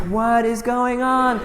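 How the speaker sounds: 1 stunned disbelief. Retold or imitated. Kids clap again, he is stunned.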